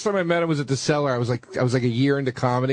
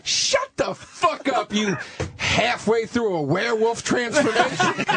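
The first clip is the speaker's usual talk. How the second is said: higher, slow, savoring each insult; brutal put-down; 7 semitones higher, slower than usual. Retold or imitated. Comic mocking a new guy's hair and beard, slow and savoring. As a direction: drag the insult out with relish. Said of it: "And he goes".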